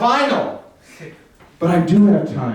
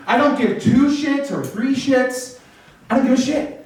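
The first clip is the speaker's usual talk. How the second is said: normal talking voice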